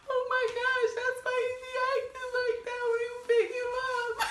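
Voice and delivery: very high, wailing reenactment of mom; hammed-up tearful squeal, half laughing; mock crying. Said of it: "this is what the reenactment".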